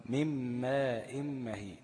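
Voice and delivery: "من ماء مهين" بغنة ممدودة جدًا على الميم; مدّ الميم المشددة في "من ماء" ببطء شديد; drawn-out ghunna on mim. Shown as a demonstration. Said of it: "طيب". (إدغام "من ماء" بغنة طويلة بطيئة)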